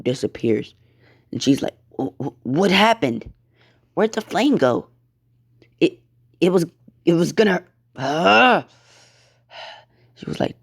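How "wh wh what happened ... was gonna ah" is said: high, halting bewilderment; stunned stammering. Acted. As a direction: stammer in shock, pitch climbing.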